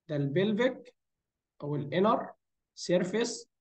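usual speaking voice

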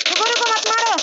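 loud, high-pitched shout to stop his teammate firing; urgent shout. Real, not acted. Gamer yelling at his teammate not to take his kill, loud and urgent. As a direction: yell it in a rush to hold fire. Begging teammate to hold fire.

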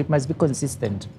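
ordinary delivery

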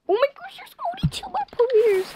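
high-pitched excited alarm; high excited squeal. Real, not acted. Tense endgame.

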